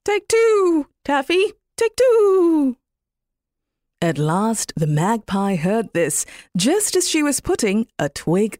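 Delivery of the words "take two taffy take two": much higher, sing-song cooing call; a daft dove repeating its call; silly dove cry. Acted. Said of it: "saying its silly cry".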